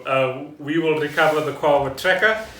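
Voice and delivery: normal talking voice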